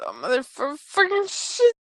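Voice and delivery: acted stammered curse leaping high at the end; shocked swearing. J-Rez reacts to the reveal in the chat, mock shock. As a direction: break off, then squeal the swear.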